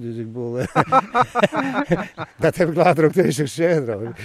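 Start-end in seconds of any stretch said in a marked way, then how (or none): 0.7-2.3 real loud laugh at his own joke. Real, not acted. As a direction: burst of high, loud laughter.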